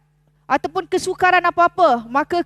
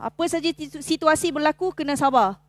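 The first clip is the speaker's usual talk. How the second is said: ordinary talk, nothing marked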